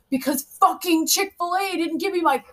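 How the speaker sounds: high, loud upset whine; mock upset rant. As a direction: vent like an outraged teen over fries. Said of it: "Why do you sound so upset?".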